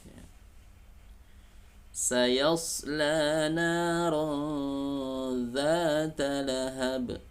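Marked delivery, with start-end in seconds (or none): 1.9-7.2 slow, high chanted recitation; Quran recitation. Shown as a demonstration. Teacher recites the verse for students. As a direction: chant it out slowly and higher.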